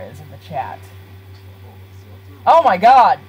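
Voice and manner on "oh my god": loud, higher-pitched exclamation; startled outcry. Real, not acted. Streamer reacting to a game mishap, startled. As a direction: cry out in sudden alarm.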